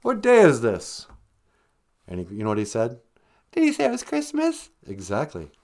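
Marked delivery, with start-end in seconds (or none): 0.0-1.0 calling out. Retold or imitated. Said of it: "I go". 3.5-4.7 wife voice. Acted. Wife asks high.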